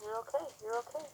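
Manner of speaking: high, soft singsong praise; soothing praise to horse. Real, not acted. Calming the horse.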